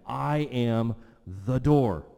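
"I am the door" drawn out slow and loud; slow, forceful "I AM... the DOOR"; emphatic declaration. Retold or imitated. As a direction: proclaim it word by word, landing hard on DOOR. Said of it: "He says it again".

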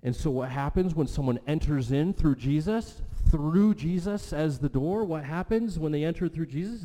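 high, loud pulpit surge; rousing preaching. Real, not acted. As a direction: fire off the question with rising fervor. Preacher surges into rhetorical question.

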